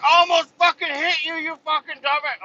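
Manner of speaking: high, loud mock rage; freaking-out yell. Angry coworker accuses driver of nearly hitting him, high mock yell. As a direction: imitate an irate man yelling way up high. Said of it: "freaking the fuck out".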